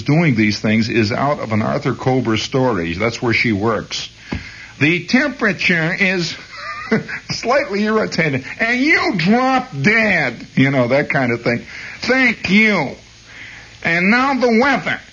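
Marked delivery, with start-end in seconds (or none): 4.8-10.5 higher, slow irritated Brooklyn delivery; querulous Brooklyn weather girl. Retold or imitated. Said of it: "slightly querulous sound in her voice". 12.0-15.0 much higher, crisp recorded-message voice; recorded phone announcer. Retold or imitated. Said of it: "It's, it goes click".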